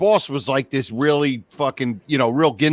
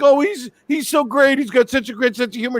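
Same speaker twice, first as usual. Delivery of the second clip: high-pitched imitation of a gushing woman; mock gushing; 7 semitones higher, faster than usual. Woman praising her boss to the roaster, mock gushing. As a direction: gush in a high mocking voice.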